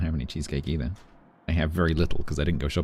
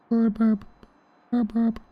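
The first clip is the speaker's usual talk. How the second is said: silly high burps; slower than usual. Pretend. Silly playful noises.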